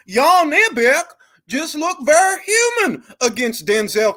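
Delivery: high, excited fan voice; mock a hyped fan, shouting. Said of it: "Motherfuckers got that twinkle".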